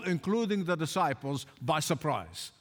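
usual speaking voice

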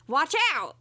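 imitated shout at modest volume; mild mock shout. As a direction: call out a warning, only half shouted. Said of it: "And he shouts".

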